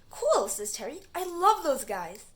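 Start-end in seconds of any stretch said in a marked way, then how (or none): 0.1-0.5 very high, loud gleeful cool; delighted cool. Acted. Terry loves the tube men. 1.1-2.2 gushing fan. Acted. Terry loves the tube men.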